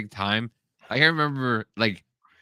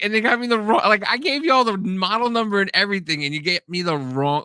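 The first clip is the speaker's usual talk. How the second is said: high, loud, fast outraged whining; indignant complaint; 11 semitones higher, 4 dB louder than usual. Retold or imitated. Narrator recalls his Christmas gift, high indignant whine. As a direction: vent like a cheated kid. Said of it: "I was like".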